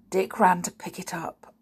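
ordinary delivery

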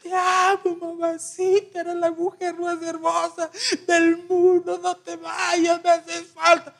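high, slow, hammed-up weeping plea; the other son at the coffin, hammed-up crying; imitated sobbing lament. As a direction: sob it out like an overdone mourner. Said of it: "Y el otro".

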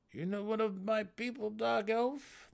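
high, probing gnome. A narrator's reading. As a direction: press him as the gnome. Gnome prompting.

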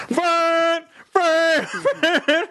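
high mock call, name over and over; imitating the driver calling for Vern. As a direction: yell his name like the guy leaning out. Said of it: "He's like".

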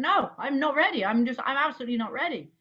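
flustered retold insistence; insistent refusal. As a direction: refuse emphatically, tumbling over the words. Retelling her repeated, firmer refusal.

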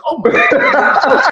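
drawn-out squealing oh my God through laughter; shocked oh my God. Retold or imitated. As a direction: stretch it out in laughing disbelief. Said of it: "And I was like".

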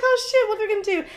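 high, hurried fretting; retold panic. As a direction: blurt it fast in mock panic. Retold panic before getting home.

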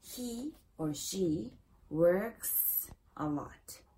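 "works" slowly, with a clear S at the end; added final S. Shown as a demonstration. Teacher models third person, "works" with clear final S. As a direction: draw out "works" to its final hissed S. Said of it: "le agregamos una S al verbo".

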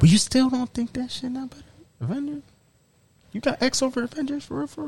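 can't believe what he's hearing; high, hushed disbelief at his friend's pick; disbelieving. Real, not acted. Disbelief at preferring X-Men over Avengers.

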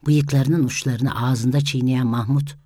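ordinary delivery

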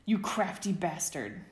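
slow, drawn-out playful yell; wrestling friend yelling, half in fun; mock yell. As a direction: shout it at him in mock outrage. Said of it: "Sirius yelled".

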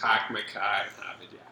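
soft, lightly done Boston accent; a hint of a Boston accent, quietly. Shown as a demonstration. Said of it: "I can do a Boston accent".

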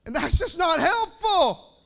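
high, loud, drawn-out rebuke; shouted preaching. Real, not acted. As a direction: cry it out at full force, stretching the words. Preacher drives home rebuke.

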